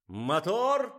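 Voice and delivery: high, loud drawn-up call; director's yell. A narrator's reading. Director starts the camera rolling, yelling. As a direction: yell it across the whole set. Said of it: "завопил режиссёр".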